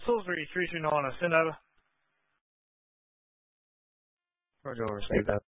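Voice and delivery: broken, crackly radio transmission; over walkie talkie. Real, not acted. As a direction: voice breaking up over a two-way radio. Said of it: "Your message is broken and unclear".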